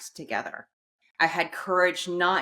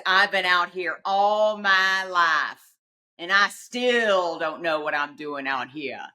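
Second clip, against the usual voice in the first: put-on Appalachian accent; 3 semitones higher than usual. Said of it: "deep Southern accent".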